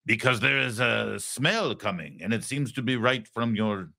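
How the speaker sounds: mock French leader in broken, accented English; bad French accent. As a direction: comically fake French accent. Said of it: "this is the worst French accent".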